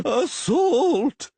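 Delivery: high, loud outraged yell; yelling official. Acted. Official yelling from the floor, tangled in his toga. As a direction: shriek it from the floor. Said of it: "yelled the skinny individual".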